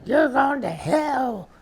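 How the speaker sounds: put-on high, loud Southern child preacher; Southern accent. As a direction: preach hellfire like a Southern kid, drawn out. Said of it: "did that in a Southern accent".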